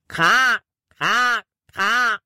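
hoher, lauter Krähenruf; Krähenruf nachgeahmt. Acted. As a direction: wie eine Krähe krächzen. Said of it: "und rief". (Jordan gibt Caleb ein Warnsignal, krächzend wie eine Krähe)

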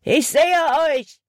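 high, strained call. A narrator's reading. Hutton spots the runaways from the gorge, calling out. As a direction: call across a distance to the fugitives. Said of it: "rief Hutton".

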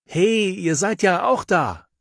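high, slow called greeting; calling out. A narrator's reading. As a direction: call across the pool. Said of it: "ruft plötzlich jemand". (langsam und hoch gerufen)

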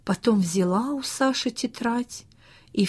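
ordinary delivery, nothing marked

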